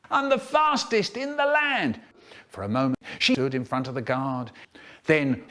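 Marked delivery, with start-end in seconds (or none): 0.1-2.0 high-pitched crowing boast; manic boast. A narrator's reading. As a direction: crow it to the guard. Miss Goodall's manic boast.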